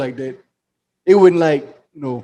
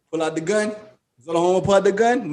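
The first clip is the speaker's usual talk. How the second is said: ordinary delivery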